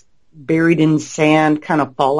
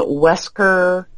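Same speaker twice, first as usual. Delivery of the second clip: ordinary talk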